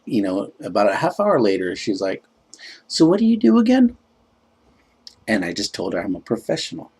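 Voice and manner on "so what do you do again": high, singsong questioning voice; high-pitched mock date; imitating the date. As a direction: mimic her in a raised pitch. Imitating date asking once more.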